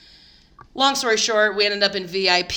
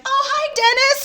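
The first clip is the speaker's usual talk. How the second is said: much higher, bright sing-song greeting; fake friendly; 9 dB louder than usual. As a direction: play it innocent and chirpy. Fake casual greeting retold.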